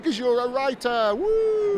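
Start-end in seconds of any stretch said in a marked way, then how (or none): 1.1-1.8 high loud whoop of delight; sudden high cheer, like a game show host; excited whoop. Real, not acted. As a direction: let out a big celebratory woo. Host cheers on meeting a writer.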